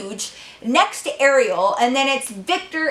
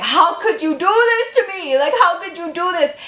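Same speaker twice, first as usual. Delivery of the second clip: higher, louder imitated yell at the sister; 6 semitones higher, 3 dB louder than usual. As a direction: shout the accusation, imitating him. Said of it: "he was, like, yelling at Ariel".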